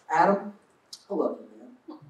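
affectionate tone. Shown as a demonstration. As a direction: say it gently, man to man. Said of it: "just in their tone of voice".

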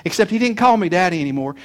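ordinary delivery

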